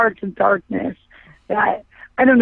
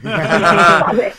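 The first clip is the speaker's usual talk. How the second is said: laughing protest; 4 semitones lower than usual. Real, not acted. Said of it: "laughing protest".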